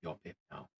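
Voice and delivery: soft, low aside as a gnome; lightly voiced gnome. Gnome runs down to the new Pip, in a soft low voice. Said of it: "runs down and goes".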